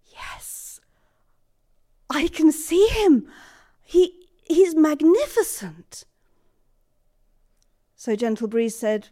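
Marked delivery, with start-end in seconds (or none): 0.1-0.8 a breathless whispered yes; awed whisper. Acted. She finally sees the invisible. 2.1-6.1 higher, louder and slow, full of wonder; awestruck wonder. Acted. Awestruck at seeing Strongwind.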